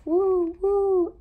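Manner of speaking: high, loud storybook hoots; owl's hoot. A narrator's reading. Reading the owl to kindergartners, high loud hooting. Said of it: "asked the owl".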